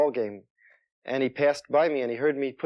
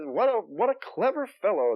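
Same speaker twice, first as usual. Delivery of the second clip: lightly voiced, higher admiring fan; admiring audience; 8 semitones higher than usual. Audience praising the comic, lightly mock admiring. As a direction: coo it like an impressed patron. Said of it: "they would say".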